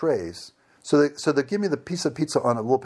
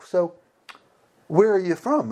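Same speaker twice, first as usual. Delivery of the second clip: lightly voiced woman, higher pitch; a higher voice for the amused server; imitated amused woman; 6 semitones higher, 3 dB louder than usual. Amused server asking where he is from, lightly imitated. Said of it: "And she's laughing".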